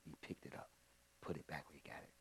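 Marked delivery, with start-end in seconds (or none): 1.2-2.1 much softer repeat of the command; almost whisper the order, still firm; near-whispered firm order. Retold or imitated. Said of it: "Now I didn't raise my voice".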